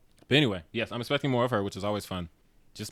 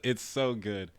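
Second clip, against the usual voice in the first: ordinary delivery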